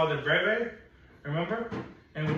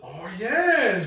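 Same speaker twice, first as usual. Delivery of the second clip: excited praise; 3 semitones higher, 4 dB louder than usual. Real, not acted. Teacher thrilled by pupil's answer.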